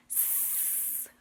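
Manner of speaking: long, soft sustained hiss; snake hiss. Shown as a demonstration. Teacher showing children the snake sound, long soft hissing. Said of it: "The snake says".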